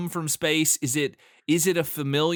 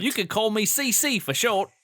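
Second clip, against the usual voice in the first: Cockney; 7 semitones higher than usual. Pretend. Cat as Cockney guy.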